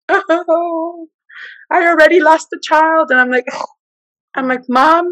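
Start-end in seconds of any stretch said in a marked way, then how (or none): 0.1-3.1 high, mild imitation of crying; mock wailing mother. 4.7-5.0 higher, insistent plea; exasperated pleading. Retold or imitated. Pleading with mother.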